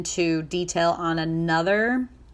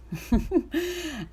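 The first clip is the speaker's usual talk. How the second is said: high laugh; 9 semitones higher, 4 dB quieter than usual. Real, not acted. Laugh after acted quote.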